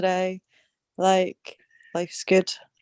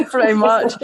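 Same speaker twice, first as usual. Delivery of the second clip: chuckling through the words, a little louder; laughing; 4 semitones higher, 4 dB louder than usual. Real, not acted. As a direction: laugh it out, amused. Laughing at Scozzie accent joke.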